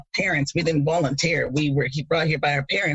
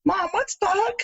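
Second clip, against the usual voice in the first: put-on much higher, innocent kid voice; little kid asking Mom; child asking; 14 semitones higher than usual. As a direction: ask Mom like a naive child. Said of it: "ask my parents".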